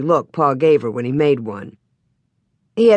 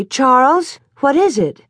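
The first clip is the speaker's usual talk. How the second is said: high, startled question from bed; startled alarm; 7 semitones higher, 3 dB louder than usual. Acted. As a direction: pitch it up, just jolted awake. Ma startled awake at night.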